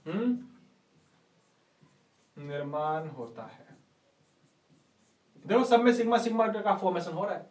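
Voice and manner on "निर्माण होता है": soft, low and stretched out; slow dictation. Real, not acted. Teacher finishes the note on sigma bonds, soft slow dictation. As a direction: murmur it slowly as if writing along. Dictating while writing on board.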